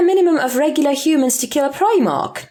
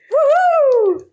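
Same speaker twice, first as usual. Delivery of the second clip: high, loud whoop; woohoo cheer; 3 dB louder than usual. Real, not acted. Cheering along with the co-host, a high loud whoop.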